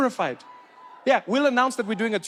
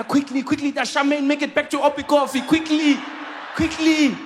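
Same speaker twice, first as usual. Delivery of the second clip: much higher and rising in loudness; mock urgent hurry; 7 semitones higher than usual. [name] mocking himself rushing home to watch TV. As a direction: hurry someone along breathlessly.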